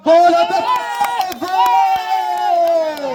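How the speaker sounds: soaring, stretched goal scream; goal call. Real, not acted. Live football goal call.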